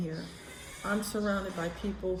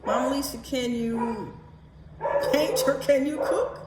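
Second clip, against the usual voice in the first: higher, louder, confused asking for help; asking the housekeeper which word she meant, flustered and loud; flustered plea; 6 semitones higher, 4 dB louder than usual. Retold or imitated. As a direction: pitch up and plead, lost between two words. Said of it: "I said".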